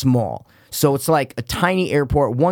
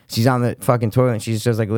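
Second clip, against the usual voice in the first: ordinary talk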